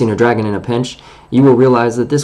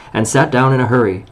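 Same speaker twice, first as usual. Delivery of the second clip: usual speaking voice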